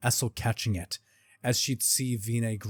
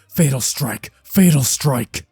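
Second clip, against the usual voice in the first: shouted attack name, building louder; loud, higher attack cry; battle shout; 4 semitones higher, 10 dB louder, slower than usual. Acted. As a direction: yell the move with mounting force. Repeated shouted attack calls.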